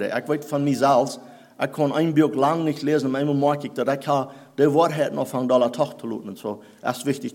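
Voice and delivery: ordinary delivery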